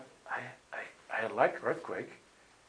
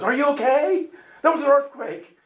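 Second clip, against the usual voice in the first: high, loud worried question; much higher, louder concerned caller; 1 worried host; 12 semitones higher, 8 dB louder than usual. Retold or imitated. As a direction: play the anxious host calling in alarm. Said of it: "calls my hotel and goes".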